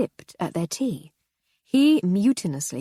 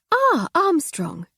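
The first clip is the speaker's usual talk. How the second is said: higher, louder and slow; lady's voice; 10 semitones higher, 5 dB louder, much slower than usual. Acted. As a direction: languid aristocrat calling out. Lady Hardcastle greets maid.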